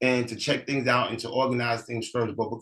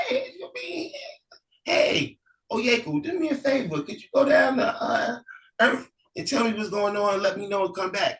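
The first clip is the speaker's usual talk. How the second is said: high, calling-out voice of the eldest brother; calling elder brother; 10 semitones higher than usual. Acted. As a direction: call down the road to your sibling. Elder brother calls from heaven.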